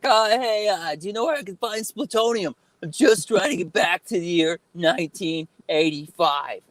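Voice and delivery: high-pitched, hesitant movie-hero impression; Marty McFly voice. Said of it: "I would do the voice".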